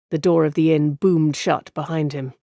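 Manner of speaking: ordinary delivery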